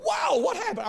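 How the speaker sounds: high-pitched startled reaction; mock astonishment. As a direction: squeal it in exaggerated bewilderment. Mimics startled reaction to preachers' change.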